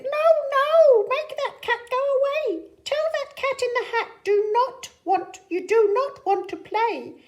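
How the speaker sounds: high, loud, fretful fish voice; fish character voice. Acted. Fish warns the kids about the cat, high and fretful. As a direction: squeak out the fish's alarm. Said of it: "But our fish said".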